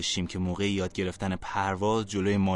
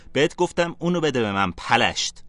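angry shout, higher and louder; 5 semitones higher, 6 dB louder than usual. Acted. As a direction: yell at the bully to give it back. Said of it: "کریم فریاد زد". (فریاد خشمگین)

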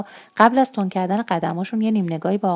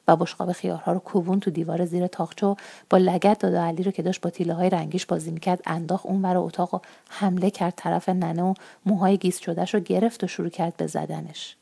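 ordinary delivery

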